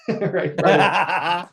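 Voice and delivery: real laughter through the words; laughing. Real, not acted. Amused at his own jab about whole life, laughing.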